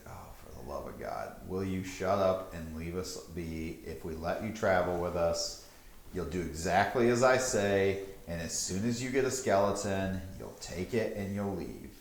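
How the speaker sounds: quiet, low fed-up terms; weary low grumble. Acted. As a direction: lay down the rules, exhausted. Kaelin, annoyed, sets terms.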